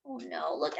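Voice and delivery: usual speaking voice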